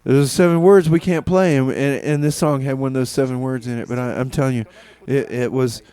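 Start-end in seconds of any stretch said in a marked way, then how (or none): none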